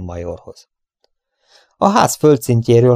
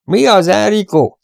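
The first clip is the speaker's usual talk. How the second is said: much higher call across the yard; 10 semitones higher, 3 dB louder than usual. Acted. Bandit Lou calling to a rider, raised call. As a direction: hail a comrade from afar. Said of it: "hallatszott Lou kiáltása".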